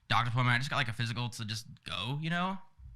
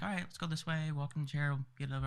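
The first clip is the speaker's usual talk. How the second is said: high, sweet lady impression; 4 semitones higher, 4 dB quieter than usual. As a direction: imitate a sweet clinic lady, pitched up. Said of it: "sweetest lady ever".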